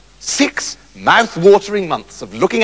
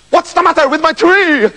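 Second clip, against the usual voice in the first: anxious king; 8 semitones higher, 3 dB louder than usual. Acted. King questions magician.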